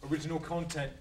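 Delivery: ordinary talk, nothing marked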